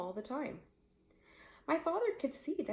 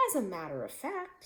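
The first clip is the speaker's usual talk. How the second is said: ordinary delivery, nothing marked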